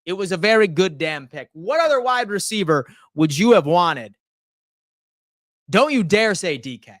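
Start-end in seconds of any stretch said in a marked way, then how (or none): none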